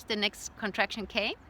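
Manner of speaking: ordinary talk, nothing marked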